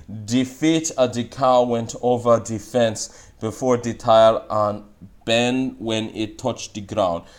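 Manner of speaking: slow Jamaican English reading. Shown as a demonstration. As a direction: read it slowly with a Jamaican English accent. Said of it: "And then we're gonna see".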